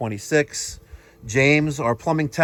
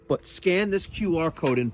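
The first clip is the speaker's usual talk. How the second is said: ordinary talk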